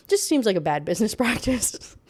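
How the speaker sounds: words breaking into laughter; laughing. Real, not acted. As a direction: crack up at own joke. Laughing at own joke.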